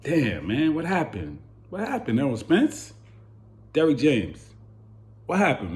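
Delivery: ordinary talk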